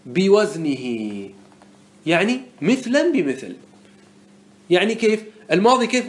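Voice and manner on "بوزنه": drawn-out chanted reading; verse recital. Shown as a demonstration. Teacher chanting a word from the poem. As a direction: stretch the verse word out slowly. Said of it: "الوزن الأول قال".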